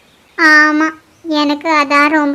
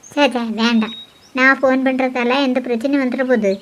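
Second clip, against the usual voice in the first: softer, lower worried self-talk; muttering to himself; 5 semitones lower, 6 dB quieter than usual. Acted. Tharun alone, fretting over a phone call, muttering softly. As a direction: mutter it under your breath, fretting. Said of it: "தனியா நின்னுட்டு புலம்பிட்டு இருக்கான்".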